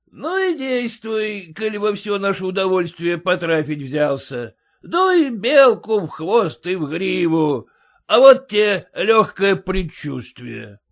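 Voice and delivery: loud, high bragging; swaggering merchant. A narrator's reading. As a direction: boast over the coachman. Merchant urges driver.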